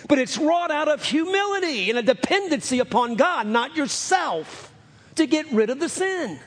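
shouted, high preaching climax; full-force preaching. Real, not acted. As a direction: drive it home to the congregation.

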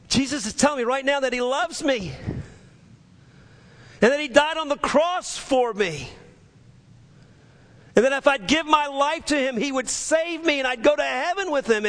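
high, urgent preacher's cry, sustained; fervent testimony. Retold or imitated. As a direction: retell a convert's testimony at impassioned pitch. Retold dying man's fervent testimony.

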